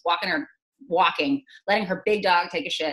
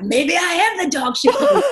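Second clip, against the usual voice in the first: higher and louder, snapping back; defiant retort; 8 semitones higher, 7 dB louder than usual. Retold or imitated. Defying the neighbor on the walk, loud retort. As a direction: throw it right back at her, loud. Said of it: "I was like".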